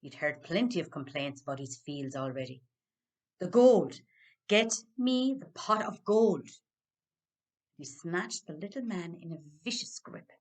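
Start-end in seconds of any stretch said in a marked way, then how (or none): 3.4-6.5 much louder, hammering shouts; furious demand. Acted. Dan shouting at the leprechaun for the gold, much louder. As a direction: bellow the order, out of patience.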